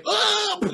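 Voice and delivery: very high, loud mock yelp; hammed-up falling scream; startled kid's cry. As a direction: yelp as if shoved into water. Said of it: "And they're going".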